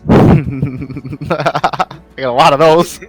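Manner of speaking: talking through real laughter; laughing. Real, not acted. Player joking to the game master about stress dice, laughing. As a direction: laugh hard around the words.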